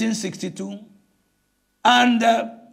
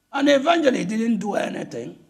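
usual speaking voice

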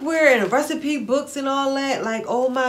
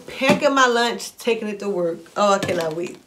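usual speaking voice

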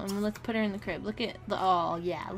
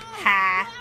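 a louder, gloating burst of laughter at her sim's bad luck; a short, loud mocking ha; 8 dB louder than usual. Real, not acted. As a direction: bark out a triumphant ha. Gloating at the bees attack.

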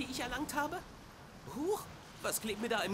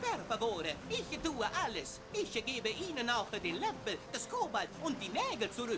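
put-on pleading with Italian accent; begging Italian plumber voice. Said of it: "italienischem Akzent".

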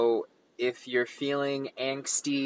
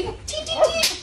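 squeaky tee-hee giggle, slow; a very high tittering laugh; high mock titter. Giggling after silly song.

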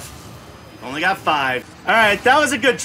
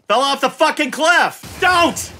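loud, higher exclamation ending in a shout; frustrated outburst; 3 semitones higher, 4 dB louder than usual. Real, not acted. Gamer who just fell off the cliff, frustrated shout. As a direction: yell at the game in dismay.

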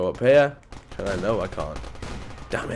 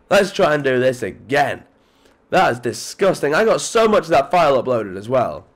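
normal talking voice

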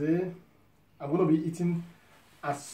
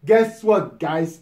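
louder insisting repeat; 2 semitones higher, 11 dB louder, slower than usual. Real, not acted. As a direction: demand the answer, stressing "Guess". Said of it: "I say, guess what".

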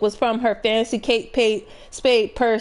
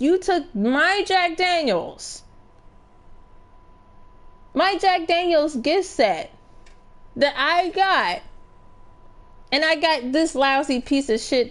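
mock angry accusation; 5 semitones higher than usual. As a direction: scold the gift thief with put-on fury. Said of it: "I will be fucking pissed".

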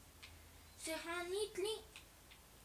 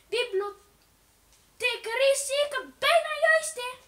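excited; 9 semitones higher, 15 dB louder, slower than usual. Real, not acted. Excited shout.

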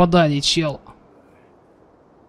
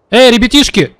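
calling out; 9 semitones higher, 8 dB louder than usual. Real, not acted. Calling out to enemy players.

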